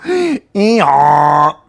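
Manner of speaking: loud, drawn-out mock-gloomy donkey moan; sharp gasp, then a long doleful groan; Eeyore. Streamer teasing a sad-named viewer, mock mournful. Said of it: "Fucking Eeyore".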